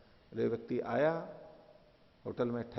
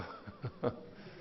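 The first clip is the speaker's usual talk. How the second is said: short breathy laugh; 8 dB quieter than usual. Real, not acted. Teacher laughs at own joke.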